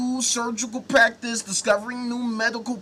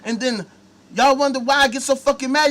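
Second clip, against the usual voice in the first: ordinary talk, nothing marked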